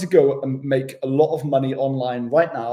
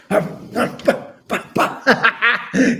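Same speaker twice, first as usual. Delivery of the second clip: high giggle; 9 semitones higher, 4 dB louder than usual. Real, not acted. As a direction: burst of high-pitched laughter. Laughs at his own joke.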